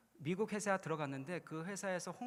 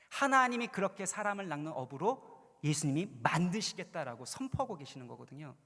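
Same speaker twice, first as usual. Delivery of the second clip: ordinary talk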